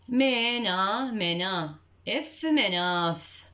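slow منا then إف مناف; sounding out. Shown as a demonstration. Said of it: "الإف المنفصلة".

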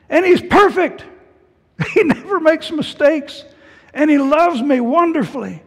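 put-on boy's sudden elated rush, laughing between lines; overjoyed. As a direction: gush it like a kid who just found a father. Teen overjoyed at finding a father.

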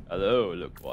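low, sultry hammed greeting; sexy voice. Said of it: "with your sexiest voice".

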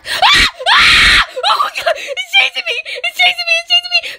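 high panicked screaming; being chased. Real, not acted. Monster chasing her in game.

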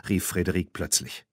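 plain reading voice

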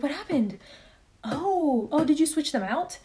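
high mock-innocent husband asking; husband playing innocent. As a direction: act like you know nothing, teasing. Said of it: "And my husband was like".

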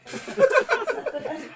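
high, slow, laughing scold. Real, not acted. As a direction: scold through real laughter. Baby pulls hair, laughing. (tumatawang pasaway, mataas at mabagal)

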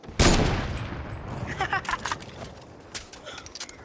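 bursting out laughing; cracking up hard. Real, not acted. Laughing at friend's kill.

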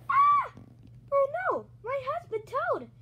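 high, loud gasp of horror; alarm. Acted. Toadette sees her husband Toad attacked, high alarmed cry. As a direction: cry out in acted distress.

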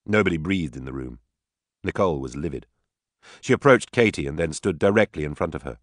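ordinary delivery, nothing marked

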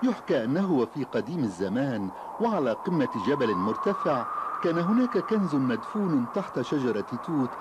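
ordinary delivery

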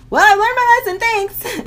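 high, loud mock-cheery thanks; sarcastic thanks. To the Uber driver, a loud sarcastic chirp. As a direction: chirp it back with fake brightness.

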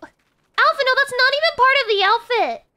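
very high, loud protest; outraged shout. Real, not acted. Streamer objecting to a character's collar, outraged shout. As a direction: yell it in exasperated disbelief.

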